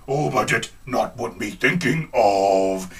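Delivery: low, slow put-on voice; playing him. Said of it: "He's like".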